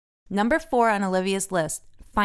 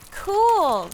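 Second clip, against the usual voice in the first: high, louder delighted exclamation; delighted cool; 11 semitones higher, 5 dB louder than usual. A narrator's reading. Francine thrilled by the bag shower, high and bright. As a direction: burst out pleased. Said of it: "says Francine".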